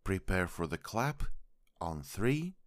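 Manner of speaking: ordinary delivery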